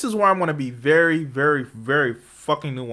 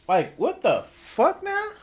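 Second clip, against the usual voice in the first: incredulous; 9 semitones higher, much slower than usual. Real, not acted. Incredulous repeat of rhetorical question.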